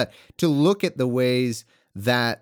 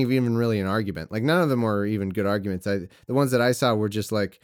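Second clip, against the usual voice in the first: ordinary talk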